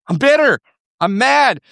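high, punched bitterness; bitter outburst. Acted. Preacher voices Naomi's complaint, bitter and mad. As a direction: throw out each short phrase high and hard. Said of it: "This is what she is saying in that sentence".

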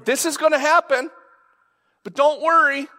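high, loud, pressing reassurance; acted urgent reassurance, high-pitched; insistent reassurance. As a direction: pitch it way up and push it. Insistent repeated reassurance as Jesus.

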